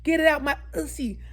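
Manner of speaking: high, loud re-enacted labor outburst; retold screaming. Mother re-enacts begging the doctor mid-delivery, high mock screaming. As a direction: act out yelling at the doctor in pain. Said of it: "And I was screaming".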